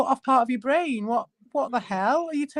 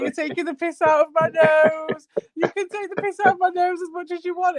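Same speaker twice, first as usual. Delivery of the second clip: high, nasal-free mock of his complaint, pitched well up; mocking whiny voice; 7 semitones higher than usual. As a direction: ham up his hurt tone, high. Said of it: "And he was like".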